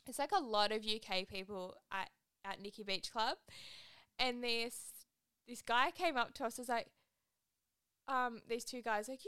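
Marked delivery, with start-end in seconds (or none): none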